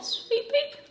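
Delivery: put-on a lightly done, high Southern accent; terrible Southern accent. Making fun of her own Southern accent, high and soft. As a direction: toss off a bad Southern accent, softly. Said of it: "I'm doing a terrible Southern accent".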